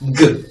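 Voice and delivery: a short G said as a hard voiced "guh"; isolated G, voiced in the throat; voiced G sound. Shown as a demonstration. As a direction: say G as a buzzing hard g, on its own. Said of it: "This is voiced".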